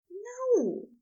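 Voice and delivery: high, dismayed denial; aghast character voice, high; horrified. A narrator's reading. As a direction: pitch the protest well up in horror. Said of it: "mouth falling open in horror".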